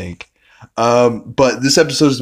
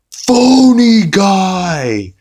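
high, slow shouted cartoon voice, much louder; phony guy voice; 12 semitones higher, 6 dB louder, much slower than usual. Recapping the show, announcing the phony guy in a high drawn-out shout.

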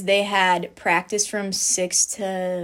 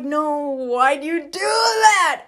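whiny protest; 6 semitones higher, much slower than usual. Retold or imitated. Retelling embarrassment at birthday announcement.

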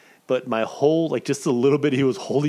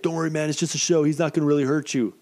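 higher-pitched imitation of bystanders soothing him; mock reassurance; 5 semitones higher than usual. Bystanders telling the wrestler's cameraman not to worry, mock reassuring. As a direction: lightly mimic well-meaning onlookers.

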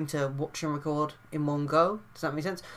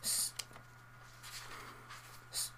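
ordinary talk

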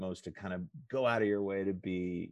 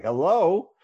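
high, loud hinting hello; eager hello; 11 semitones higher, 12 dB louder than usual. Retold or imitated. Guest retells volunteering himself, eager hello. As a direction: jump at the chance, mock-eager. Eager reply to invitation hint.

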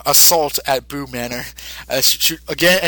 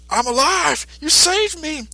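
joyful relief; 13 semitones higher, slower than usual. Retold or imitated. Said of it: "Satan wakes up and says".

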